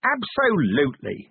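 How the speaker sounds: high, drawn-out exclamation; mildly shouted, high-pitched agreement. A narrator's reading. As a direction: cut in with emphatic, stretched words. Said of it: "he shouted".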